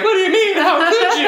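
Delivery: imitating a blindsided family member in mock outrage; mock shocked family. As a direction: play the scandalised relative, over the top. Family caught off guard, mocked.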